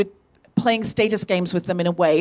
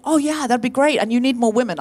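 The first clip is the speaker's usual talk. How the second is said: higher, friendly and agreeable; really nice voice; 5 semitones higher than usual. Shown as a demonstration. Performer to festival guy, showing her really nice voice. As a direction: say it nicely, pitched up and encouraging. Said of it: "I said it in a really nice voice".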